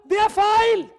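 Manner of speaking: loud, hammering it home from the pulpit; emphatic shout. Real, not acted. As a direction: shout the correction, full conviction. Preacher refutes a common view. (rop det ut med full overbevisning)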